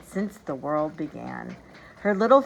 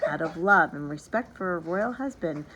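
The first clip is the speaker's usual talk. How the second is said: usual speaking voice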